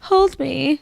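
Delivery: put-on cling like a child in trouble; clingy little girl. Mom imitating her daughter wanting cuddles, whiny child voice. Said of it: "she's just like".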